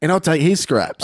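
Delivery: put-on British; British accent. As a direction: pitch up into a fake British accent. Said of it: "I'll be a British guy".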